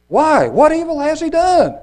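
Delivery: high, loud, impassioned question; Pilate's protest. A narrator's reading. Pilate challenges the crowd, crying out in protest. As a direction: call out the challenge at high pitch. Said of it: "Pilate said unto them".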